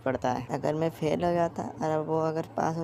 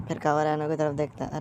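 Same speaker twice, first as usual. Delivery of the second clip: ordinary delivery, nothing marked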